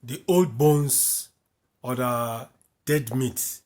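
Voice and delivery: ordinary delivery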